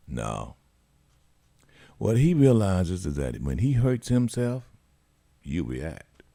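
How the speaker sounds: normal talking voice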